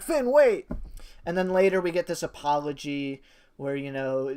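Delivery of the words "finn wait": calling out. Retold or imitated. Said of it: "I was like".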